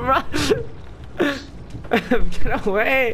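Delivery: high frantic yelling through laughter; panicking gamer. Real, not acted. Fleeing zombie in game.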